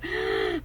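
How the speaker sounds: a quick breathless gasp of delight. Real, not acted. Seeing the card, a quick surprised gasp.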